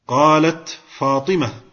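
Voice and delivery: "قالت" ending in a vowelless t before "فاطمة"; sukun on the ta. Shown as a demonstration. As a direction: close "قالت" on a bare t, no vowel after it. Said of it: "فسكنت هنا التاء". (تسكين تاء "قالت" قبل "فاطمة")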